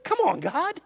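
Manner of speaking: high, incredulous whine; hammed-up disbelief at God's request; mock whiny protest. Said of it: "you would sit there and go".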